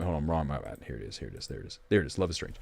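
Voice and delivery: very low, barely audible mumble; hushed self-talk, dropping away; muttering to self. Real, not acted. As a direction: murmur under the breath while fumbling for something. Fumbling to bring up picture.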